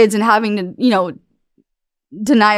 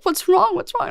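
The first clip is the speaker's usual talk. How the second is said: worried asking; 10 semitones higher than usual. Retold or imitated. Officer asking her on the arrest tape, higher worried questioning. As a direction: lightly voice someone anxiously checking on her. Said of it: "they're like".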